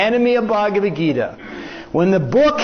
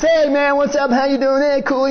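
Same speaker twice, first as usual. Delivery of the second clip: higher-pitched mock small talk; acting cool; 5 semitones higher than usual. Old friends making small talk to impress, acting cool. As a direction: run off cool-guy greetings on one note. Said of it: "trying to act like you're somebody, you know, cool".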